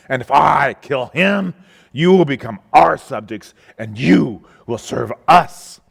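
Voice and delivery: defiant battlefield challenge, loudly projected; Goliath. Acted. Champion challenging an army to single combat, shouting defiance. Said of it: "he shouted his usual defiance".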